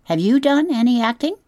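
high, bright question; eager opossum. A narrator's reading. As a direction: lean in, keen to know. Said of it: "said the opossum".

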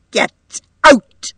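high, loud, explosive order; explode, throwing her out; frantic cry. A narrator's reading. Mother cuts off her guest and orders her out, frantic cry. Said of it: "cried Missus Markey frantically".

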